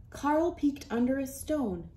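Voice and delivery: ordinary delivery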